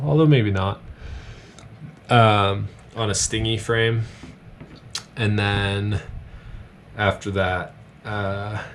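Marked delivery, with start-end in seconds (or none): none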